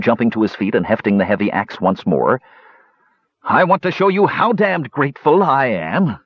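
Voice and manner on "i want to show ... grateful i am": high snarled threat; menacing hobgoblin. Acted. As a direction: threaten with mock gratitude.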